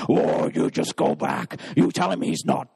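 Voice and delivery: low whispered devil, brushing him off; impatient devil. Acted. Devil orders the demon back to the man, low and impatient. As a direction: send the minion back with a low hiss.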